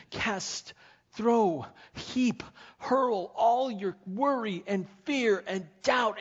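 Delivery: God pleading with the worried listener, high and loud; God's pleading voice. Acted. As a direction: plead as a loving God urging surrender. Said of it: "He says".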